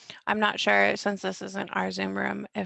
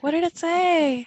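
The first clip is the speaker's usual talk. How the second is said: puzzled high question; confused people; 8 semitones higher, 8 dB louder, slower than usual. Retold or imitated. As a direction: ask it high and confused, slowly. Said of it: "people are always like".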